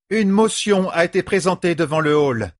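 high-pitched projected proclamation; commanding announcement. A narrator's reading. The leader calls the hall to order, commanding. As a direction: ring out over a brawling assembly.

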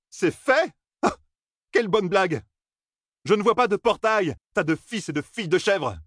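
high, sarcastic disbelief; incredulous scoffing. A narrator's reading. Matt mocking the strangers' claim, high and sarcastic. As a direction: scoff at the joke you've been handed. Matt mocks their answer.

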